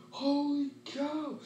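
high, very slow, stretched out; mock astonishment; awed holy cow. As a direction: draw it out in exaggerated wonder. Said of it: "It was like".

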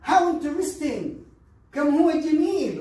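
drawn-out, bright admiring tone; high, loud admiration. Shown as a demonstration. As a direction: sound truly impressed, slowly. Teacher models admiring reply.

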